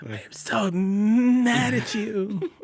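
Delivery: mock-outraged YouTuber, high and drawn out; ranting YouTuber. Cohost parodying angry Marvel video makers, hammed-up high rant. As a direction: draw it out in a high, hammed-up whine. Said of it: "the guy's yelling into it".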